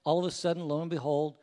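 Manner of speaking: ordinary talk, nothing marked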